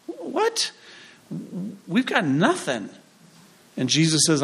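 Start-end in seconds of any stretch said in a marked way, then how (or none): 0.1-2.9 sudden very high "What?" then a flat protest; a disciple taken aback, squeaking in surprise; shocked disciple. Retold or imitated. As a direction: blurt out stunned disbelief.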